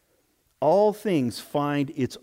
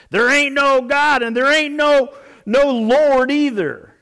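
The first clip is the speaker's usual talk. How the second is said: loud, much higher mocking yell; yelling back; 11 semitones higher, 8 dB louder than usual. Atheist neighbor yelling over the fence, loud scornful shout. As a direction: holler it over the fence at her. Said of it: "he would just yell back over the fence".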